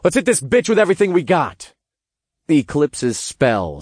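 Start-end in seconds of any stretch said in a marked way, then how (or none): 0.0-1.8 fast, high-pitched rallying cry; rally the team in a rush; calling out. A narrator's reading. Narrator launches the team's attack, calling out urgently. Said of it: "I called out to the tattooed succubus".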